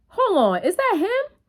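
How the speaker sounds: high, rising mock-startled question; alarmed double-take. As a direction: imitate a cousin suddenly spotting someone, pitch leaping up. Cousin spots the man following.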